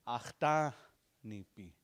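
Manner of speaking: "achtanipi" drawn out slowly and clearly; slow model word. Shown as a demonstration. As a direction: spell "achtanipi" out slowly for students. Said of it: "what was that word".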